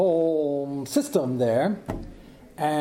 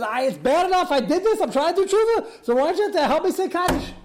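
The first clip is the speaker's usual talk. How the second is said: pleading quote voiced much higher, a hint of tears; mock-tearful begging, pitched up; retold tearful plea; 12 semitones higher than usual. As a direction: plead like a desperate prisoner, mildly imitated. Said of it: "He's crying hysterically".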